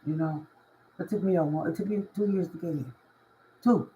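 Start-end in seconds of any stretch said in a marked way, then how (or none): none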